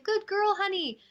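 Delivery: a burst of relieved pride for the good dog; delighted praise. Retold or imitated. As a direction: gush over the dog for waiting at home. Praising dog found on porch.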